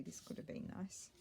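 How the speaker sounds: whispered thought to herself; voiceless musing. Real, not acted. Murmuring to herself while trying.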